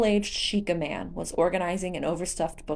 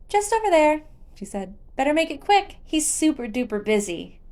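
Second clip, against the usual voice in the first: put-on much higher, louder child voice, bright and quick; little girl greeting the visitor; 9 semitones higher, 4 dB louder than usual. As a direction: chirp it like a small girl. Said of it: "smiled serenely".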